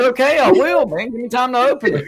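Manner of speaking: high voice cracking with laughter; laughing wrestler voice. Pretend. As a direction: hype it through giggles. Acted Randy Savage.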